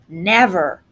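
a low, mildly growled word; a lightly voiced stormy growl; growling Thunder. A narrator's reading. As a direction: let Thunder rumble his answer. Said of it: "Thunder growled".

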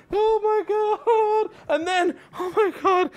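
민망해서 웃음 섞인 높은 목소리; cringing laugh. Real, not acted. Cringing at his own story.

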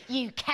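"care" as Cockney for cow. Shown as a demonstration. Actress relishing the best Cockney word, "care" for cow. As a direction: say "care" with a broad Cockney vowel, meaning cow. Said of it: "Cow, cow in Cockney is the best word".

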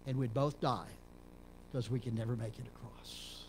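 normal talking voice

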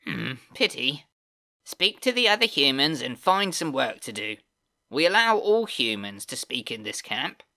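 high, whiny sneering goblin voice; screeching whiny goblin. Acted. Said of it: "with his screeching, whiny voice".

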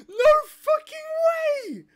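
very high, slow, tearful yell; overjoyed shout. Real, not acted. Fan realising the Snyder Cut is real, slow tearful shout. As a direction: drag it out in a crying scream of joy. News confirmed, overwhelmed fan.

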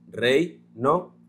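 rey, no as two beats; rey-no said in parts; syllables split. Shown as a demonstration. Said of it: "forman una sílaba".

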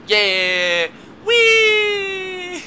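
high, loud joyful squeal; gleeful whee. Real, not acted. Gamer riding a minecart down the rails, squealing with joy. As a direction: squeal like on a ride.

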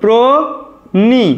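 প্রনি drawn out very slowly as "pro-ni"; slow model syllables. Shown as a demonstration. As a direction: stretch প্রনি as "pro-ni", rising. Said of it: "তাহলে আমরা লিখব".

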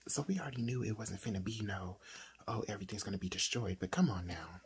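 hushed aside. Real, not acted. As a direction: keep it low so nobody wakes. Late-night hushed aside.

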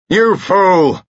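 furious high-pitched shout. A narrator's reading. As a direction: shout it in outrage, loud and high. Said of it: "shouted Carados".

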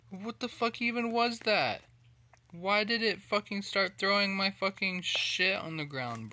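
loud, high furious rant; angry rant. Real, not acted. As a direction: rage at the game. Gamer raging at the glitch.

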